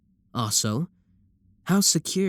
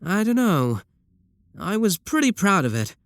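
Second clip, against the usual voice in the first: cheeky; 8 semitones higher than usual. Acted. Sibling cheekily contradicts.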